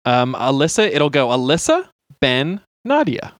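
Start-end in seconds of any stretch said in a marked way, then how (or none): none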